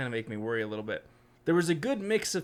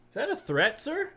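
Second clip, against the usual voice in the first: mock alarm; 7 semitones higher than usual. Real, not acted. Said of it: "mock alarm at the quote".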